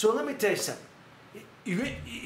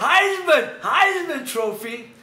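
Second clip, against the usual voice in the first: loud, higher, laughing burst; laughing correction; 9 semitones higher, 10 dB louder, much slower than usual. Real, not acted. Catching his own slip on the trophy name, laughing loudly. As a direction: blurt it out through a laugh.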